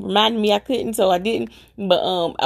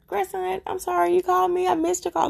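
tender apology; 9 semitones higher than usual. Retold or imitated. Grandmother apologizing to grandson by phone.

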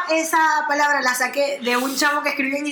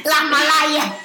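laughing; much slower than usual. Real, not acted. Laughing repeat of the word.

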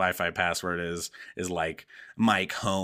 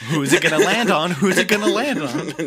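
high, loud sing-song taunt; mock lunatic; 8 semitones higher, 7 dB louder than usual. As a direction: play the gleeful madman spinning the gun. Villain spinning gun, lunatic.

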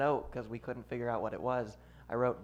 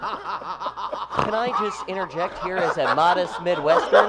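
mock Midwestern man; 5 semitones higher, 7 dB louder than usual. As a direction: soothe the two arguing New Yorkers. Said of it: "Your voice is soothing".

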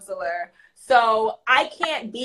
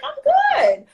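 put-on little boy voice; 14 semitones higher, 3 dB louder than usual. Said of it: "He's like".

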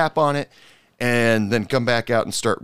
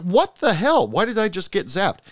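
startled outburst; 10 semitones higher than usual. Retold or imitated. Bartender zapped by a light fixture, shocked outburst.

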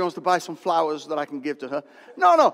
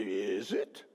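doubtful aside; 9 semitones lower, 9 dB quieter than usual. Retold or imitated. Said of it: "You say".

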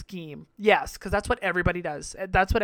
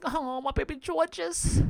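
higher, slow cooing; cooing pity; 7 semitones higher than usual. Retold or imitated. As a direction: coo over a nervous favourite. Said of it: "I'm just like".

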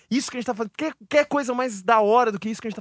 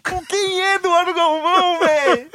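a slowed, high, louder bewildered question; mock panic; 7 semitones higher, 5 dB louder, slower than usual. Recalling his baffled search for the caller, in mock panic. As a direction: ham up the confused search.

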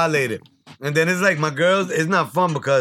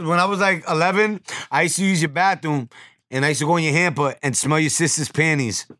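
ordinary talk

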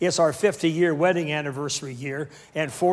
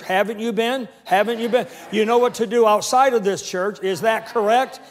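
loud, raised, rallying preacher voice; full-force preaching; 5 semitones higher, 5 dB louder than usual. Real, not acted. Preacher rallying soldiers of the cross, full-force preaching. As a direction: build to a shouted declaration for them to echo.

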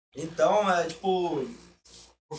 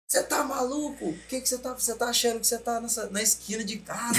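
angry father; 7 semitones higher than usual. Retold or imitated. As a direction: scold like a furious father. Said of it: "bravo, bravo, bravo".

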